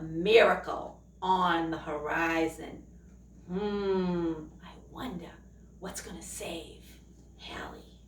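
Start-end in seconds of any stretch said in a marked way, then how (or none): 3.5-8.0 hushed, wondering aloud; suspenseful whisper. Pretend. Reading to children, a wondering suspense whisper. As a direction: draw the kids in with a hush. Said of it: "suspense whisper to kids".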